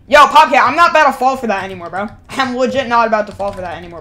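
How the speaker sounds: ordinary talk, nothing marked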